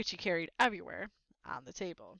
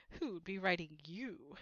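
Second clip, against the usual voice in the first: sneer; 2 semitones lower, 5 dB quieter, slower than usual. A narrator's reading. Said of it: "sneered Uncle Vernon".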